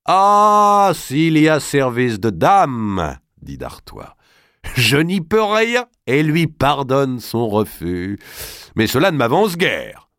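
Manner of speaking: high, loud, commanding noble; a forceful lord giving urgent orders; Robert d'Artois, bold lord. A narrator's reading.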